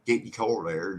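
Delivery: "core" for car, rounded vowel; regional car. Shown as a demonstration. Showing how we say it, "core" for car. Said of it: "We say core".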